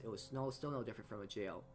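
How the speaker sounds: ordinary delivery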